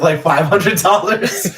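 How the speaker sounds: telling the Jordans punchline to friends, breaking into laughter; laughing. Real, not acted. Punchline of the coach's story.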